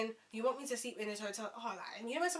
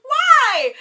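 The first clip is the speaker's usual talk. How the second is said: a huge high wail of despair; anguished shout; 24 dB louder than usual. Real, not acted. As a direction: cry out to the sky in disbelief. Cab breaks down, cries out.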